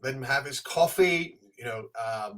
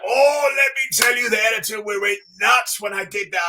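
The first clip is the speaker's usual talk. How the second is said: higher and louder, eager and animated; lively recounting; 6 semitones higher, 4 dB louder than usual. Retold or imitated. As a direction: tell it like a proud war story. [name] recalls the broken panel.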